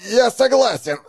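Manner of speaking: forced out while choked, high; strained, choking. Acted. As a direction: squeeze it out through a grip. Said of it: "с трудом ответил тот".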